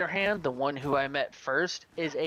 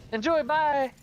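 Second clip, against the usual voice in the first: high, loud, singsong send-off; taunting farewell; 9 semitones higher, 6 dB louder, slower than usual. Retold or imitated. Teabagger signing off to a beaten player, taunting. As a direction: toss off a gleeful mock goodbye, pitched up.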